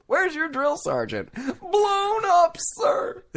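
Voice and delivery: very high squeaky soldier voice; movie quote voice. Retold or imitated. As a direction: squeak it like a scared recruit.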